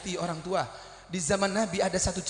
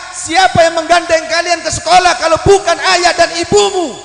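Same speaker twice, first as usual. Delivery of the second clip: loud, high preacher's cry; fiery preaching; 12 semitones higher, 18 dB louder than usual. Acted. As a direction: thunder the questions at the crowd. Preaching at full force. (khotbah berapi-api dengan suara lantang)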